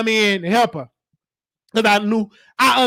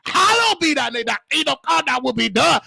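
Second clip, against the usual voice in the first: high, fervent recited prayer; praying aloud; 4 semitones higher than usual. Retold or imitated. Caregiver retelling a prayer at work, fervent praying. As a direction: cry it out like a church prayer. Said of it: "I was praying".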